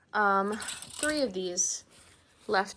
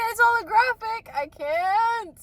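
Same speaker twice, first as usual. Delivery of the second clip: excited squeal; 12 semitones higher, 5 dB louder than usual. Real, not acted. Gushing over new item.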